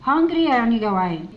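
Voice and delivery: ordinary talk, nothing marked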